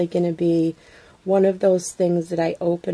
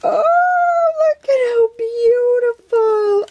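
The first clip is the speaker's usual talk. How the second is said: high, loud, slow squeal of delight; overwhelmed happy squeal; excited squeal; 7 dB louder, slower than usual. Real, not acted. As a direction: gush over the gift with a cry in the voice. Opening a handmade gift.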